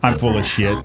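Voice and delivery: low, sinister villain voice; evil voice. Acted. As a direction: sneer it like a horror villain. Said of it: "You guys like my evil voice".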